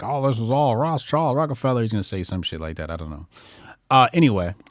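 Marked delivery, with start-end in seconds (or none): 0.0-1.8 lightly voiced, mildly higher mock of a co-host; mocking Jonah. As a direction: tease a friend with a hint of his voice. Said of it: "I know Jonah's gonna be like".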